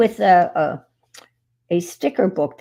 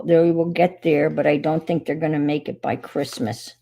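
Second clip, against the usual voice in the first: normal talking voice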